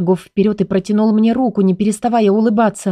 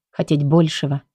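ordinary delivery

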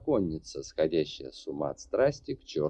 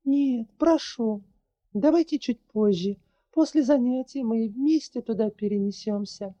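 put-on pleading young woman; 13 semitones higher, 3 dB louder than usual. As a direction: beg him gently but urgently. Larri pleads.

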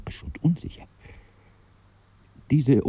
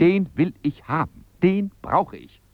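high, emphatic insistence; stubborn demand; 9 semitones higher, 4 dB louder than usual. Acted. Customer demanding the shoe from the display, insistent. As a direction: insist on having it.

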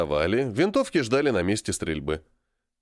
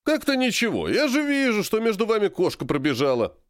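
high, insistent coach voice; pushy gym coach; 10 semitones higher than usual. A narrator's reading. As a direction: press her like a nosy coach. Coach presses Polina, high.

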